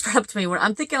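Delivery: usual speaking voice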